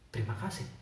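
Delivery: hushed, low questioning disbelief; quiet acted astonishment; startled. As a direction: repeat it very softly, taken aback. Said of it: "Bapak ini langsung terkejut".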